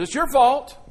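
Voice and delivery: mock accusation at a funeral; accusing. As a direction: blame them to their face. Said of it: "somebody walks over and says".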